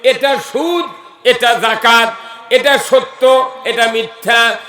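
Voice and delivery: loud, emphatic sermon delivery, last word drawn out long; full-force preaching. Acted. Preacher warning the crowd about usury, full-force preaching. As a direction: preach it loudly, ending in a sustained chant.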